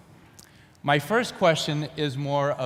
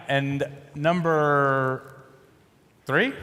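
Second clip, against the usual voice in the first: normal talking voice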